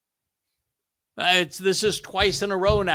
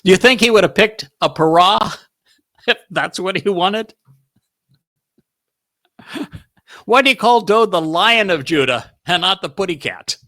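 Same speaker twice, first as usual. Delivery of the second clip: loud challenge; 3 semitones higher, 7 dB louder than usual. Real, not acted. Preacher challenging congregation loudly.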